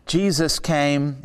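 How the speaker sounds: ordinary talk, nothing marked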